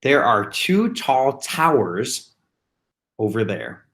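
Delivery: slow, clear sentence for spelling practice; slow dictation. Shown as a demonstration. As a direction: read the example sentence slowly so children can write it. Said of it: "dictation sentence read slowly".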